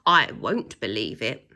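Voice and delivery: a low, loud, stubborn shout; shouted refusal. A narrator's reading. Second teacher shouts his refusal, low and loud. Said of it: "shouted another".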